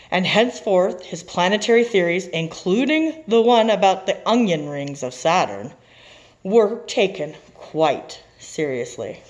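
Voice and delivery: story narration. A narrator's reading. Teacher reading the happy ending, triumphant narration. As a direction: wrap up the tale with a flourish.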